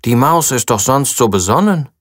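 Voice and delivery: usual speaking voice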